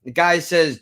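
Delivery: usual speaking voice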